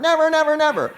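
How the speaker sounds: high, loud, mock-shrieked "never" said three times; hammed-up defiant yell from the old mother. Mother yelling back at daughter.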